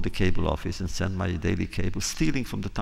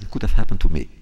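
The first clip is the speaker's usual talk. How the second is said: flat, loud punchline delivery; punchline of the friends' joke; deadpan punchline; 11 dB louder, faster than usual. Retold or imitated. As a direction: land the joke dryly. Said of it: "He said".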